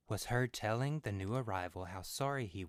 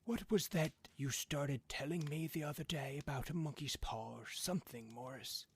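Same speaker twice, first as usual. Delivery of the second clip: put-on a higher-pitched old man griping about the mud, a touch of anger; grumbling old man; 6 semitones higher than usual. A narrator's reading. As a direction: complain like a fed-up old father. Old man voiced in story.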